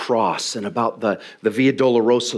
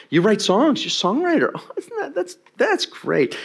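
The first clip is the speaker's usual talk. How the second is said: much higher, cooing over credentials; mock impressed gushing; 12 semitones higher than usual. Preacher voicing God reading your card, mock impressed. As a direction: gush as if humoring someone.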